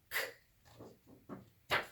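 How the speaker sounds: whispered k puff; k sound. Shown as a demonstration. Teacher whispering the "K" sound to kids. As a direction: breathe a voiceless "K". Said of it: "C says".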